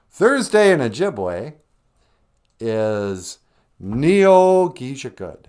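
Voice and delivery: "Neo Gijakud" said very slowly and carefully; Ojibwe word, slow. Shown as a demonstration. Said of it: "how do you say Thursday".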